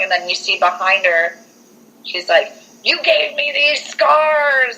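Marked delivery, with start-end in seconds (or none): 2.8-4.8 high, drawn-out ghostly accusation; mock accusing wail. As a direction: imitate an aggrieved ghost, dragging the words.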